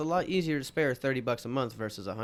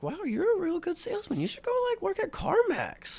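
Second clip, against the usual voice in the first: imitating the lady. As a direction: squeak it out as the impressed lady. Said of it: "And she was like".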